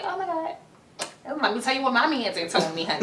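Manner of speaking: mock a clueless girl bragging about her man; mocking naive girlfriend. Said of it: "Don't be like".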